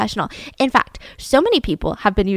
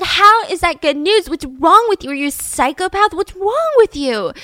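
higher, indignant mock outburst; pissed off; 8 semitones higher, 4 dB louder than usual. Crying teammates to the man with good news, imitated angry outburst. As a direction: retort in raised, outraged pitch. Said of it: "everyone just is so pissed".

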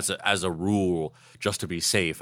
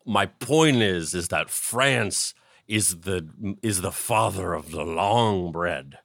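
slow, deliberate explaining; slow mock lecture; slower than usual. [name] explaining his love of bread, slow and deliberate. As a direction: spell out the point slowly.

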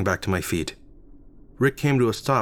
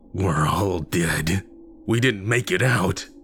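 creepy cackling corpse; slower than usual. Acted. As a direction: drag it out like a grinning corpse. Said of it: "he cackled".

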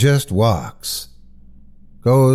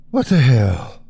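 breathless fright; 4 semitones higher than usual. A narrator's reading. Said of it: "the woman said in a breathless voice".

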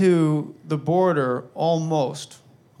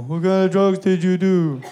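lightly voiced claymation person, laughing through it; a hint of a little stop-motion kid, cracking up; 2 semitones higher, 3 dB louder than usual. Sons quizzing their dad, laughing claymation kid voice. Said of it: "like little fucking claymation people".

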